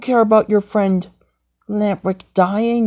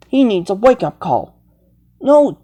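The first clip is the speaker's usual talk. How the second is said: usual speaking voice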